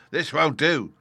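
high, loud, rallying pirate; rally the frightened crew; pirate captain voice. A narrator's reading. Silver rallies the crew.

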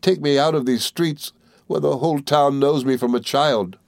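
mildly shuddering appeal; dreadful shiver. A narrator's reading. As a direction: beg to be taken away, with a touch of a shudder. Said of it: "with a dreadful shiver".

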